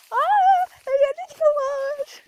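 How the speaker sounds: very high, loud, slow gasp of discovery; surprised. Real, not acted. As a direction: shout it out in delight. Spots friend unexpectedly.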